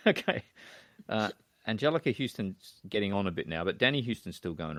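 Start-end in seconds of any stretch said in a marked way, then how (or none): 0.1-0.4 amused, rising okay with a laugh; chuckling disbelief. Real, not acted. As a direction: laugh it out, pitch jumping up. Amused at nobody knowing.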